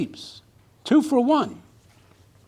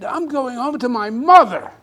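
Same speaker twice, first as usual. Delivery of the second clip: mock scream; 3 semitones higher, 8 dB louder than usual. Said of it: "She started screaming".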